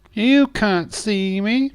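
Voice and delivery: mock the wrestler's signature taunt; playful wrestler impression; John Cena catchphrase. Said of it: "John Cena's just battered A J Styles".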